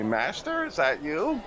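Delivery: a small servant calling out hopefully to his master; meek goblin. Acted. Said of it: "a little meek-looking goblin".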